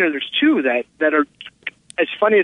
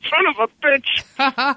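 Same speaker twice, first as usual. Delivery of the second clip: high, drawn-out mock curse; retold indignant outburst; 10 semitones higher, much slower than usual. Dad reacting to the producer's idea, mock outrage.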